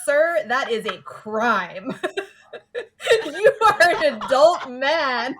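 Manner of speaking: mock-outraged laughing rebuke; laughing outrage. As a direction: scold him through laughter. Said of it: "And I was like".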